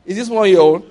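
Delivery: a loud, laughing challenge to the man; pointed question. Retold or imitated. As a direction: lean in and demand an answer. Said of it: "he called the man".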